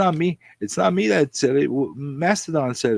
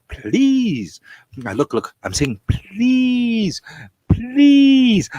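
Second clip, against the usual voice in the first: drawn-out, high-pitched please; nice pleading; 7 semitones higher, 5 dB louder, much slower than usual. Pretend. Streamer begging viewers to do as asked, pleading. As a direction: beg loudly, stretching out each please. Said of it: "I'm saying it in a very nice way".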